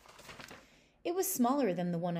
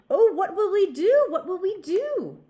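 much higher, loud, frantic frog voices; panicked frogs; 14 semitones higher, 15 dB louder than usual. Acted. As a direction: squeal in comic panic. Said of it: "they cried to the weasel".

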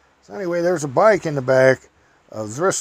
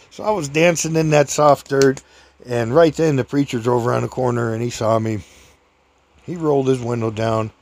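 usual speaking voice

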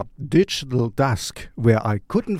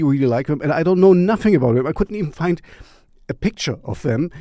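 ordinary talk

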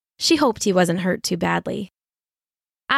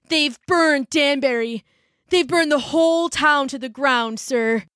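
put-on young messenger; 5 semitones higher than usual. Messenger boy tells the colonel Danbury burned, higher and shaken. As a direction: break the grim news.

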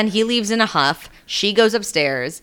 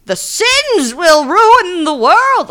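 high, loud, slow doom-preacher cry; scary witch hunter; 13 semitones higher, 8 dB louder, much slower than usual. Acted. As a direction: thunder it like a fanatical bishop, drawing out the words. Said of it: "that's the scary, uh, uh, witch hunter guy".

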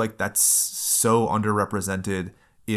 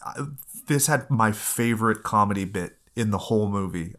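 ordinary talk, nothing marked

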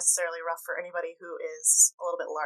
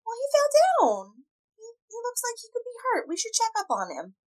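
high character voice; 4 dB louder than usual. Acted. Pleading with Keljor.

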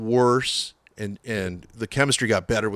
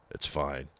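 low, soft imitated reply; quiet resignation; 8 semitones lower, 11 dB quieter than usual. Singer giving in to the sound man, imitated quiet resignation. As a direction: drop low and give in quietly.

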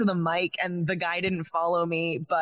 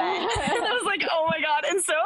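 laughing; 10 semitones higher than usual. Real, not acted. Friend suggests texting bet.

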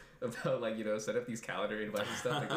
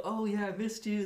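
bright high welcome voice; sweet high greeting; 12 semitones higher, 4 dB louder than usual. Retold or imitated.

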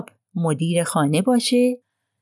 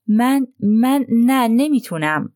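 stammering distress; 4 semitones higher, slower than usual. A narrator's reading. Roddy cornered by Poirot's question, stammering and distressed. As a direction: falter over the words, cornered.

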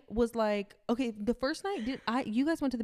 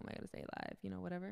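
quiet; 3 semitones lower, 16 dB quieter, faster than usual. Retold or imitated. Retold cautious thought.

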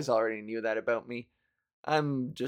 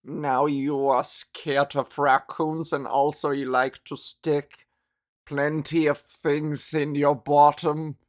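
loud, slow mock psychoanalyst; mock Freud; 6 dB louder than usual. Podcaster mocking Freud's diagnosis, loud and slow. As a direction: lecture her like a pompous Freud, louder. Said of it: "Freud would be like".

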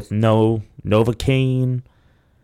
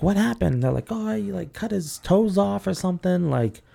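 higher, quick questioning voices; nurse and family; 8 semitones higher than usual. Retold or imitated. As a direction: voice the worried exchange, pitched up. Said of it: "the woman's like".

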